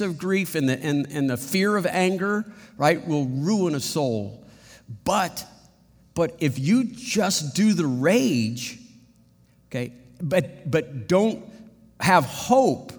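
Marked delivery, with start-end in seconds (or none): 5.0-12.9 preaching emphasis. Real, not acted.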